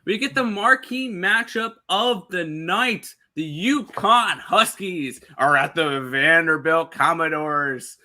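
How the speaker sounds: slow, drawn-out ring-announcer build; big-game announcer hype. Pretend. Host billing the last game to viewers, announcer hype. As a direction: stretch each team name for maximum hype. Hypes the featured matchup.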